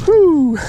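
giddy cackling after a whoop; real triumphant laughter; elated whoop and laugh. Real, not acted.

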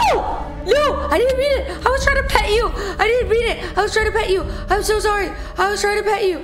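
panicked yelling. Real, not acted. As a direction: yell your innocence in a panic. Llama attacks after she tries petting.

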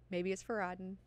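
softly musing to oneself; tentative, softly spoken hunch; quiet realization. Acted. First thought, then emphatic repeat.

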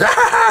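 very high mock scream; short piercing creature shriek; imitated villain shriek. Gamer imitates the flower's reaction, high shriek. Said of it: "And he goes".

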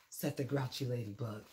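usual speaking voice

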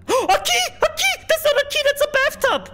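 high, startled cries of disbelief; surprised squeal. Real, not acted. Gamer startled by a bathtub, high surprised yelps. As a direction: yelp it out, amazed it's a bathtub. Finds a bathtub instead of key.

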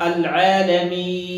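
العالمين with the final ee held four counts; four-count madd. Shown as a demonstration. Teacher demonstrates second ruling, العالمين with medium stretch. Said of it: "أربع حركات".

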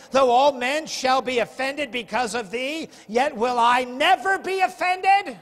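loud, high, slowed vow of loyalty; Peter's boast. Retold or imitated. Preacher voicing Peter swearing to Jesus, loud proud boast. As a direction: proclaim it like a boastful fisherman.

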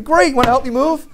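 very high sing-song ask; spring the trap sweetly; high hammed-up favor request; sly mock pounce.

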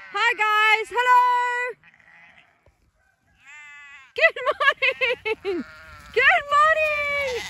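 high, slow cooing greeting. Real, not acted. Farmer greets her lambs in a high cooing voice. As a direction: coo at the lambs like babies.

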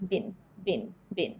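"been" said short, like "bin"; short vowel. Shown as a demonstration. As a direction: keep every "been" short, American style. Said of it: "ออกเสียงสั้นเสมอ".

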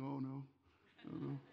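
timid mumble after being caught; sheepish backing off. Retold or imitated. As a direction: caught out, pretending it was nothing. Student backs off, caught out.

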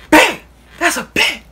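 retold yelling. Coworker yelling a challenge to step outside, loud and high. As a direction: bark the challenge like you're daring him outside. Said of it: "he yelling, screaming".

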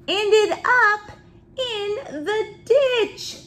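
read-aloud building to a high climax; suspenseful story reading. A narrator's reading. Reader tells children of bike crash, building suspense. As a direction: climb in pitch, then draw out the ending.

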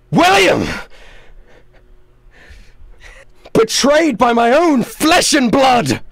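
high, loud, slow cry of betrayal; betrayed villain. Acted. Villain cries out at his betraying brother, loud and slow. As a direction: wail it like a wronged brother. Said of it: "and he goes".

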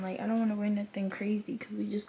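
soft unvoiced whisper; whispering casually at night. Real, not acted. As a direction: keep it hushed. Quiet at home, whispering.

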